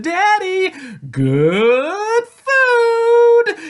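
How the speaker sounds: put-on very high, loud child voice, words drawn out; eager little girl. A narrator's reading. Tia shows her father the sweets, eager squeal. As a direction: squeal it and stretch each word. Child pleads for ice cream.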